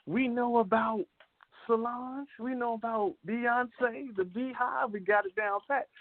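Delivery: high, sustained pitch for the listeners' reply; imagined audience. Acted. Host acting out what listeners probably say, high-pitched imagined audience. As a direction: voice the know-it-all public, pitched well up. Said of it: "I know you probably say".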